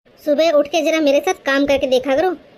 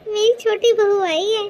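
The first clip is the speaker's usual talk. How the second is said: put-on a touch higher, fond elderly mother-in-law; doting mother-in-law; 5 semitones higher, 4 dB quieter than usual. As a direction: bless the young bride like a pleased saas. Mother-in-law greets daughter-in-law. (हल्की सी ऊँची, लाड़ भरी सास की आवाज़)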